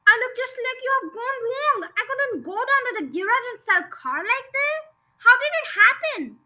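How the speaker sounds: storyteller acting an appalled yell; yelled in dismay. A narrator's reading. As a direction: wail in disbelief at the sight. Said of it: "yelled the father".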